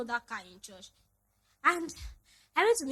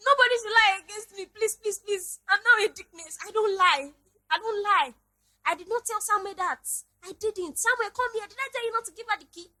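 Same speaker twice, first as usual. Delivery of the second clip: mother's higher, pleading voice; imitating mom pleading; 8 semitones higher, 6 dB louder than usual. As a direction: plead like a mom insisting she's innocent. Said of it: "That was how my mommy did".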